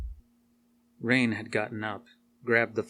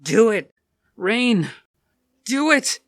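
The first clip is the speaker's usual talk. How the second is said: very loud, high scream; screaming demand; 12 semitones higher, 11 dB louder, slower than usual. Acted. Rain screaming at Alice to finish it. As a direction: scream it at full force.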